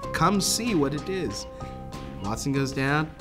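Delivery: ordinary talk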